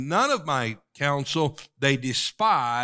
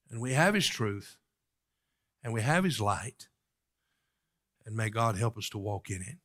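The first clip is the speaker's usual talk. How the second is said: ordinary talk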